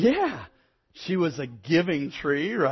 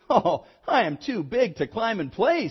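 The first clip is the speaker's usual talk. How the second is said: lightly voiced growing boy; 7 semitones higher, 5 dB louder than usual. A narrator's reading. Boy turns down the tree.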